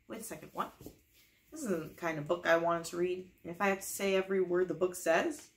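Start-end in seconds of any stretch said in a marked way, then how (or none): none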